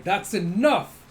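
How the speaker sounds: a stranger cutting the fight short; commanding shout. Retold or imitated. Mystery figure halting the fight, mock commanding shout. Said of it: "a figure rising up and going".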